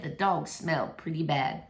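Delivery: usual speaking voice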